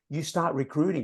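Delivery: usual speaking voice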